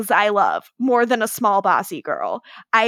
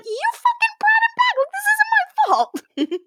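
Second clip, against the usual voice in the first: pitched way up, indignant squeal; whiny defensive Cole. Retold or imitated. Friend imitates Cole blaming Davina, high indignant whine. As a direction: whine it up high like a brat who won't take blame.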